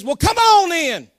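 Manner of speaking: loud, high, casual welcome; Jesus hollers a breezy invite across the water, loud and high; Jesus inviting. Retold or imitated. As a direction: call out a cheery come-on-in. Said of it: "and Jesus says".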